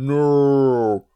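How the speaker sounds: long, loud drawn-out cry; Darth Vader wail. Acted. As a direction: imitate an anguished villain's distant howl. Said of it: "hear him off in the distance going".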